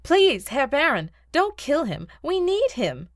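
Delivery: put-on high, imploring young woman's plea; urgent pleading. Agatha begging the Baron for a life, pleading.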